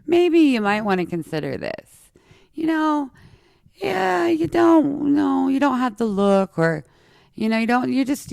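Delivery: high, mocking, concerned-adult voice; imitating naysayers who talk down sweetly; doubtful adviser. People discouraging her dreams, in a high mocking voice. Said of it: "someone would be like".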